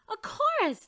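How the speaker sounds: high, loud delighted cry; loud excited exclamation. Acted. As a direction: burst out with joy. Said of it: "Dolores cried".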